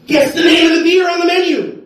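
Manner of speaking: high, loud question to the crowd; mock incredulous shout. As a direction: shout it as the absurd final reveal. Comic shouts the punchline setup.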